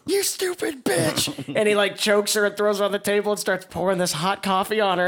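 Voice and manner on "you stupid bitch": high, slow mock-angry snarl; enraged husband. Retelling the PSA where the husband turns on the waitress, high mock rage. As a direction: ham up the abusive husband. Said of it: "And he goes".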